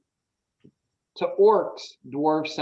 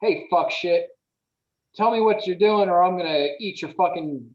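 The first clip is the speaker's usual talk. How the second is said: higher, louder crude threat; orc version; 5 semitones higher, 4 dB louder than usual. Shown as a demonstration. GM showing how the same request sounds in Orcish, crude and louder. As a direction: snarl the curses like an orc. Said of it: "It's more like".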